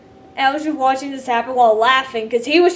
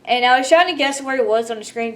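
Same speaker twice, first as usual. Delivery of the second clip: normal talking voice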